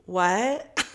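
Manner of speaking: questioning "what?" with rising end; acted-out disbelief, pitch lifting at the end; baffled. Her baffled reaction to landlord.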